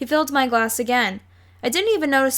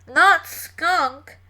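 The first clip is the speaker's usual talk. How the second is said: held-nose voice; 5 semitones higher, 4 dB louder than usual. A narrator's reading. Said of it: "sounded as if they had the worst colds".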